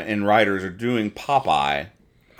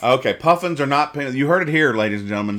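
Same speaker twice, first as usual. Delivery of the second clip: higher and louder, hammed-up proclamation; mock announcement; 6 semitones higher, 5 dB louder, faster than usual. Real, not acted. Host mock-announcing the correction to listeners, hammed up. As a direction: proclaim it like breaking news. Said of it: "mock announcing to audience".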